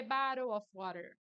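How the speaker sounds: bottle of water as baro of warer; every T in bottle of water flapped like R; American flapped T. Shown as a demonstration. Teacher demoing the phrase Brits dread. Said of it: "o T vai ser muito substituído por R".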